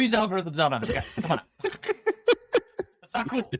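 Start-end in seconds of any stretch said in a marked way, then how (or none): 1.6-3.5 high, slow laughing protest. Real, not acted. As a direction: giggle through it playfully.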